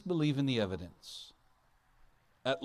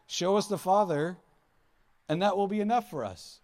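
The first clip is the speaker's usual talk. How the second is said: higher, louder, eager plea, a lightly voiced disciple; pleading Philip; 7 semitones higher, 7 dB louder than usual. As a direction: beg to be shown the Father, hammed up a touch. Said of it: "He says".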